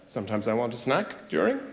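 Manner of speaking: quiet and low; low sheepish mumble. Acted. Acted reply, low and dropped.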